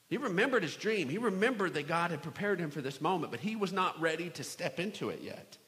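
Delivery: ordinary delivery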